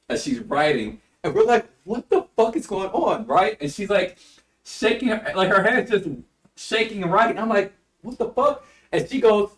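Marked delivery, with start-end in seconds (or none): 1.8-3.3 high, incredulous retold question; baffled reaction. Student recalling the class watching the teacher's shaking hand, baffled. 8.0-8.6 incredulous reaction. Retold or imitated. Student reacting to teacher's shaking handwriting, incredulous. Retelling own disbelief at shaking hand.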